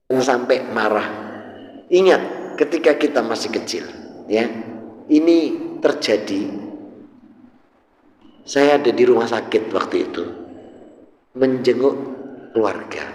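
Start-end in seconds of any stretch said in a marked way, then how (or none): none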